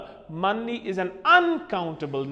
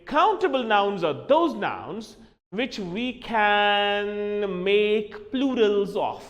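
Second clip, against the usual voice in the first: slow, drawn-out teaching delivery; deliberate, measured dictation of a rule; emphatic definition; slower than usual. Shown as a demonstration. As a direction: stretch it out slowly for the class. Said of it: "You'll say".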